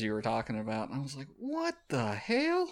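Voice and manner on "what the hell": slow, high disbelief; drawn-out what the hell; shocked. Retold or imitated. Reacting to shocking comic page.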